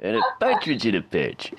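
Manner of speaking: Rod Serling. Retold or imitated. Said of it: "you sound like Rod Serling".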